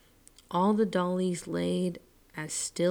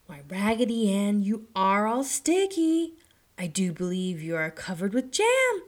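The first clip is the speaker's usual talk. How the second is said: put-on girl discovering sticky jam; surprised girl voice; 2 semitones higher, 6 dB louder than usual. A narrator's reading. Mistress scolds.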